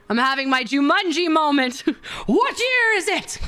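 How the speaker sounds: very high, hammed-up bewilderment with laughs; over-the-top Jumanji castaway cry; mock panicked outburst. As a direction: cry out in exaggerated disbelief, breaking into laughter. Acts out a Jumanji moment.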